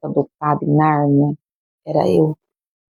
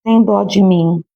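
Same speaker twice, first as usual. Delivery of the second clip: high, slow, whining self-pity; mock whiny plea; 4 semitones higher, much slower than usual. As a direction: whine it out like a pitiful plea. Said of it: "a gente fala assim". (imitando o crente choramingando com Deus)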